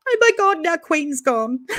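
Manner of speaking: high, loud yelp turning into a laugh; real shock breaking into giggles; surprised and laughing. Real, not acted. Host reacting as a guest vanishes, with a loud, high, laughing yelp. Guest suddenly drops off stream.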